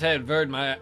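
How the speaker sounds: usual speaking voice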